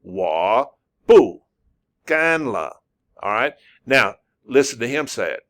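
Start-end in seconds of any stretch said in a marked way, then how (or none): 0.0-2.8 careful, exaggerated WA, BU, GAN LE; demonstrating Chinese tones. Shown as a demonstration. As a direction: give each word its own tone, separated. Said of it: "how to say 'em".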